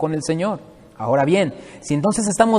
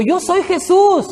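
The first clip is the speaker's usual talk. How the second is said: much higher, slow and solemn; a preacher voicing Jesus, high and slow; 11 semitones higher, 4 dB louder, slower than usual. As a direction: proclaim it slowly, lifted high. Voicing Jesus in retold scene.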